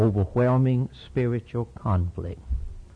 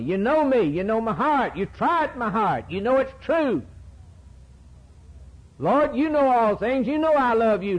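pleading prophet, higher and urgent; Jeremiah praying; 7 semitones higher than usual. Acted. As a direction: cry out to God as a troubled prophet. Preacher voices Jeremiah's prayer.

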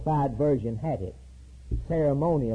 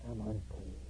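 much softer, lower echo of the leper's cry; faint fading cry; 7 semitones lower, 16 dB quieter than usual. Retold or imitated. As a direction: let the cry die away as if far off. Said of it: "he was to cry out loud".

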